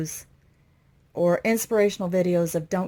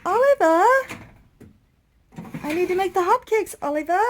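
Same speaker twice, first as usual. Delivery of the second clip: much higher, put-on voice calling out to Oliva; high character voice calling for Oliva; 10 semitones higher, 4 dB louder than usual. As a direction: play a different woman calling out to Oliva. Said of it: "you know what I keep thinking".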